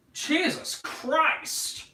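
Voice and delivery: exasperated. Real, not acted. Exasperated at bad albums.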